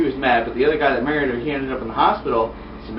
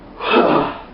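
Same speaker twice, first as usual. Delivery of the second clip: big sigh; 2 semitones lower than usual. Real, not acted. Storyteller pauses mid-recap, big sigh. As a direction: let out a big breath.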